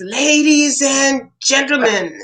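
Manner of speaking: put-on hammy child host. Small boy opening his own birthday party, hammy announcer. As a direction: stretch it out like a little emcee. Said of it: "He was always a ham".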